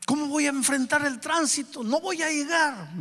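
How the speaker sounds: high-pitched, anxious fretting, acted out; nervous fretting. Father waiting in the car for his son, acted nervous and high. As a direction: play a father getting worked up about being late. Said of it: "Me comencé a poner nervioso".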